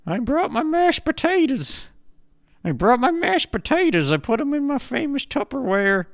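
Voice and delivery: put-on high, old grandma voice, judging; squeaky granny nagging her grandson. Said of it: "And she goes".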